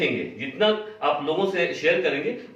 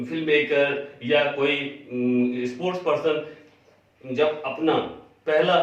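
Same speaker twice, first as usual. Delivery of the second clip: ordinary talk, nothing marked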